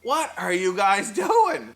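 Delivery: high, drawn-out mock yell; mock high shout. Older kids on bikes shouting down at stuck boys, mock high yell. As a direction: call down from the creek bank, hammed up high. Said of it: "and they're like".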